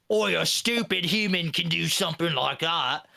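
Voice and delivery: rough-accented cartoon orc. Orcs amazed by a badass commissar, orc voice. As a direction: put on an astonished orc's voice. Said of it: "the orcs on the battlefield".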